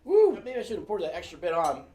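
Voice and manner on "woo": high, loud whoop as the heat hits; loud woo from spicy heat. Real, not acted. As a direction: let out a big yelp of heat. Reacting to burning hot wing.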